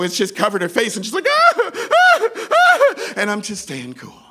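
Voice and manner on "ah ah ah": repeated very loud high screams; piercing mock "aah" screams; imitated horrified shrieks. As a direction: scream in bursts like someone caught in vomit. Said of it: "is now in vomit stereo".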